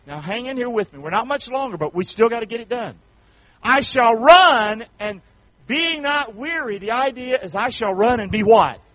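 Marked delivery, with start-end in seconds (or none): 3.6-6.8 slow, high, stretched scripture; slow stretched preacher scripture; slow emphatic scripture. Real, not acted. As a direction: draw each word out slowly. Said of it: "scripture read again".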